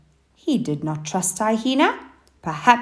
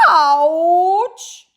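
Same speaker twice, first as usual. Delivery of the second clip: high, loud howl of pain; 11 semitones higher, 6 dB louder than usual. Acted. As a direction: yelp it out as you land. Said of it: "she howled".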